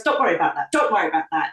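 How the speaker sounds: low, matter-of-fact retold reassurance; forthright. Spirit guide waving away her embarrassment, forthright and low. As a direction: brush it off firmly, voice dropped.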